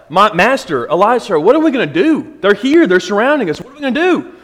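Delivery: put-on frantic cry of a terrified young servant; scared servant. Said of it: "He's scared. He's like".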